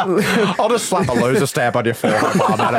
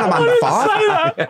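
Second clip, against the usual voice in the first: asked much higher and slower, "You reckon I'm under five?"; fishing for praise, pitched way up; mock show-off; much slower than usual. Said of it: "he goes".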